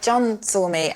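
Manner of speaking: ordinary talk, nothing marked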